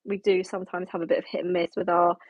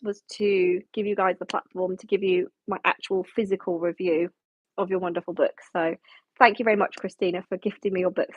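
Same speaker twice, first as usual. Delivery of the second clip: ordinary delivery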